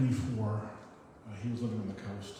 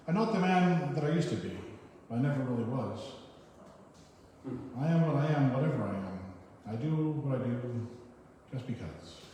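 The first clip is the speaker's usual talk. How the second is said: poem reading; 3 semitones higher than usual. Acted. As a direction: declaim the lines to the room. Reads a short poem.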